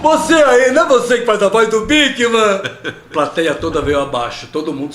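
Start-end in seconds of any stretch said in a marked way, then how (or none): 0.0-2.6 high, loud excited question; excited call-out. Retold or imitated. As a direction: call out eagerly from the back. Fan calls out from back.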